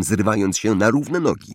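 plain reading voice

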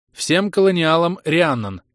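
slow, loud, even robotic address; an AI broadcasts to the whole colony; machine announcement. Acted. The colony AI announces to all colonists, loud and machine-even. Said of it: "Ровный бесполый голос машины".

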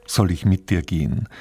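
normal talking voice

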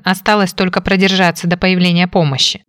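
ordinary delivery

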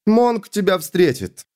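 slow, high voice over a helmet radio; woman's voice through a helmet; inside a space helmet. Acted. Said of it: "раздался её голос в шлеме".

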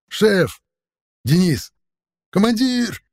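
high desperate calls; shouting. A narrator's reading. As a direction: yell his name over the roar. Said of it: "кричали ему".

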